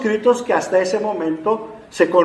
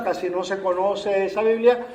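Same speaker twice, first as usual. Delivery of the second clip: ordinary talk, nothing marked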